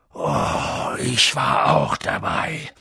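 very low, slow rumble; menacing giant. A narrator's reading. Giant tells the uncle he witnessed it, menacing. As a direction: drop deep and draw it out.

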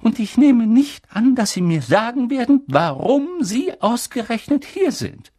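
astonished stern professor demanding answers; agitated witch teacher. A narrator's reading. Professor marvelling at Harry's survival, high and excited. McGonagall voiced.

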